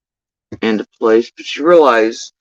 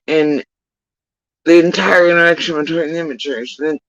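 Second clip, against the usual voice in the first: usual speaking voice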